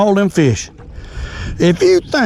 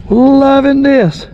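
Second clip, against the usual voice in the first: thrilled; 8 semitones higher, 4 dB louder, much slower than usual. Real, not acted. Thrilled angler watching fish swarm.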